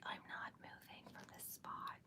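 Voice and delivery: barely audible whisper; very soft, whispered retelling; hushed self-quote. Retold or imitated. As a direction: whisper it under your breath, imitating yourself. Quoting self after baby left.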